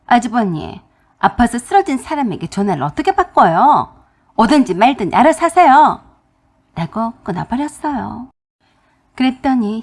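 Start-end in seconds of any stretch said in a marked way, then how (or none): 0.1-6.0 rising into a loud angry retort. Retold or imitated. Daughter-in-law scolding her brother-in-law, building to loud anger. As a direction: snap back and hang up. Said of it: "화가 나버렸어요".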